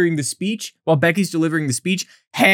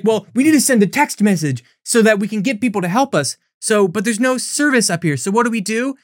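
mock clueless planning; 6 semitones higher than usual. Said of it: "they're like".

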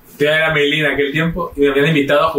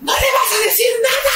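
high mock screech of protest; outraged mother. Mother berates father for staying calm, imitated high screech. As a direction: hysterical mother scolding, hammed up. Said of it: "mi mamá así de".

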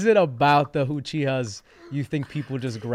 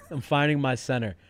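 ordinary talk, nothing marked

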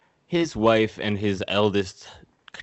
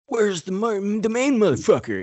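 higher, hammed-up demand; angry Hanzo; 12 semitones higher, 3 dB louder than usual. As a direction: call out for the boss in a put-on voice. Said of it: "And then he's like".

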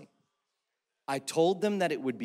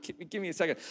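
softer, embarrassed aside; dad quietly stalling the ride attendant; hushed aside; 6 dB quieter, much faster than usual. Retold or imitated. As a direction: murmur it so the kids don't hear.

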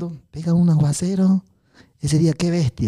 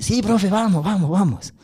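put-on eager boy; 6 semitones higher, slower than usual. Teacher voices eager boy David.

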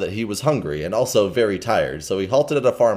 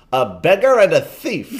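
accusing; 8 semitones higher, 5 dB louder than usual. Acted. King exposes Hugo.

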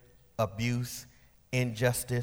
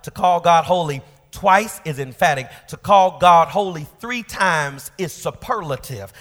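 usual speaking voice

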